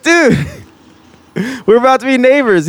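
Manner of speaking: high, thrilled outburst, quoting himself; giddy replay of a stoked call; re-enacted excitement. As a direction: blurt it high like huge news. Retells his excited phone call.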